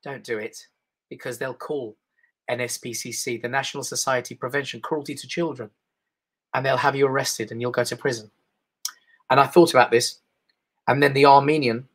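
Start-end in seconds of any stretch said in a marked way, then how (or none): 0.0-8.3 much lower, soft, calm warning; British inner voice. Retold or imitated. Inner British voice warning he will be arrested, quietly. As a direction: murmur it like a cautious conscience. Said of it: "the British voice in me said".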